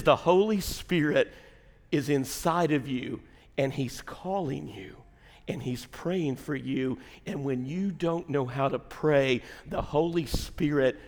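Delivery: slow, soft, low preaching with spaced phrases; slow right down and reassure them softly; tender preaching. Real, not acted. Preacher telling the guilty the Spirit prays, gently.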